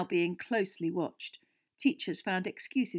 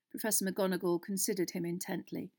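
plain reading voice

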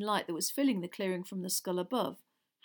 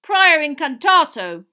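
slow, high, very loud roar; roared spell; 8 semitones higher, 19 dB louder, slower than usual. A narrator's reading. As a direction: bellow the incantation. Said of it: "roared Mister Diggory".

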